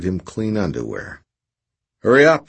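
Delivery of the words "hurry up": loud, high commanding call. Acted. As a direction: bark the order loud. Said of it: "she commanded".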